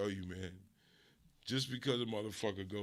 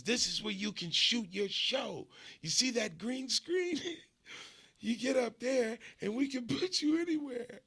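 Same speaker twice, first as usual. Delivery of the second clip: much higher, eager hustler voice pitching the green screen; excited cousin; 11 semitones higher, 4 dB louder than usual. Retold or imitated. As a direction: sell the dream like a hyped cousin.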